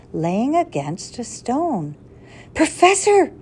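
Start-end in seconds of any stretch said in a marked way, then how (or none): 2.5-3.3 high excited call, pitched up; boy shouting his discovery; excited cry. A narrator's reading. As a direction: call out thrilled by the find. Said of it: "he cried excitedly".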